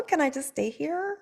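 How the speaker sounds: usual speaking voice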